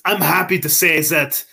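put-on botched Russian accent slipping into German; hammed-up Germanic accent; German-sounding Russian accent. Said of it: "this is German as fuck".

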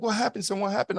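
high, anxious mom asking her son; worried mother imitation. Imitates his worried elderly mother.

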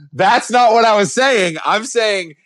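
laughing. Real, not acted. Laughing protest.

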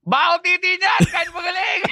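high heckling shout; court heckling. Retold or imitated. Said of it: "Maririnig mo sa basketball court".

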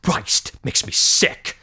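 a calm madman savouring his plan; scheming villain. Acted. Sinister villain monologue intro.

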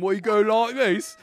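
hammed-up British accent; mock British, badly done. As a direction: put on a silly British accent. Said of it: "try to do a British accent".

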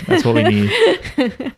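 high, slow laughing words. Real, not acted. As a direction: laugh through the line. Laughing agreement, pitch jump.

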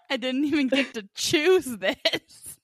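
slow, high mock complaint; mocking whine. Host parroting co-host's complaint about the picture, mocking whine. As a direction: draw it out, imitating a whiner.

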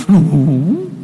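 drawn-out "No" swooping up; child's denial. Acted. Threatened son still denying everything, child's denial. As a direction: keep denying it like a stubborn kid.

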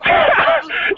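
a burst of high laughter. Real, not acted. Laughing at the rival's refusal, high cackle. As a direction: crack up, high-pitched.

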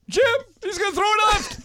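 very high, loud commentator squeal; shrieky send-up of an excited announcer; Tony Romo impression. Shown as a demonstration. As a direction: pitch it way up and shout the prediction. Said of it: "You gotta give him high".

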